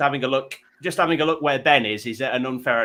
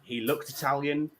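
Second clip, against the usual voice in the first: ordinary delivery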